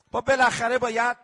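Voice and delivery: normal talking voice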